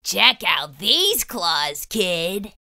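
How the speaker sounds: slow, rasping monster growl; raspy monster voice. Acted. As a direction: rasp it out low and slow. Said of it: "a third voice from under the bed rasped".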